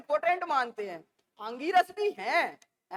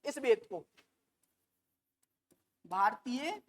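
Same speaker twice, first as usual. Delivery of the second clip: each word spaced out, fading quieter; slow dictation; 5 semitones lower, 7 dB quieter, slower than usual. Shown as a demonstration. Teacher dictating the definition to students, slow and fading. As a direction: spell it out word by word for writing. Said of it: "teacher dictates word by word".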